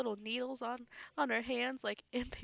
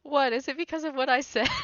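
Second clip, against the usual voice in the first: mock surprise; 4 semitones higher, 5 dB louder than usual. Real, not acted. Teasing mock surprise at opponent.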